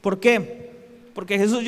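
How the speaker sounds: normal talking voice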